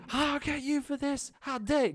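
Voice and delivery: high, shrill-pitched furious halfling yell; furious halfling yelling from the water; angry yell. Acted. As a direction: scream it after your enemy. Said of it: "yelling, screaming, saying".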